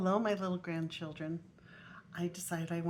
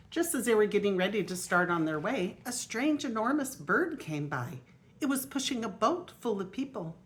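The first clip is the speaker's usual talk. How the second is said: lively storybook reading aloud; grandma reading a picture book aloud; reading to children; 5 dB louder than usual. Acted. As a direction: read it out to the little grandchildren.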